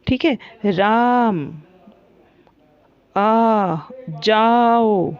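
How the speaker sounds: slow call with राम and आ held long; drawn-out calling. Shown as a demonstration. Teacher demonstrates calling राम, vowels drawn out. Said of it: "इस तरीके से जो बोलते हैं".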